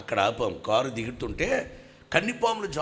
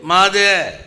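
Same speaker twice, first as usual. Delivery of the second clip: loud claim; 5 semitones higher, 14 dB louder, much slower than usual. Retold or imitated. Claiming the stage.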